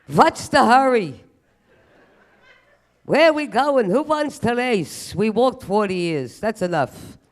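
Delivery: put-on loud, slow, high kvetching old-timer. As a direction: whine it like an old man who won't hurry.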